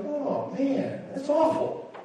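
high, soft mock dismay; acting out a muted reaction to bad news; unemotional sympathy. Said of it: "I'm not really an emotional guy".

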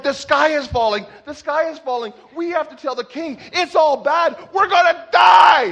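high alarm building to a shout; mock panic. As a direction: escalate into hammed-up doom. Panicked warning acted, building to doom.

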